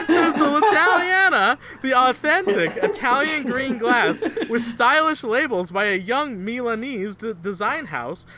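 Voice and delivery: mock ad read. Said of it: "mock ad read of water brand".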